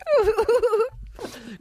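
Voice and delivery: very high squeaky laugh; high giggle. Pretend. Comic trying the new laugh, high squeaky giggle.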